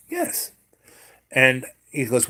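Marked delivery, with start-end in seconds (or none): 0.1-0.5 put-on small, high, soft child voice; imitated timid little kid; child's meek answer. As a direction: answer the policeman in a tiny high voice. Himself as a child answering policeman.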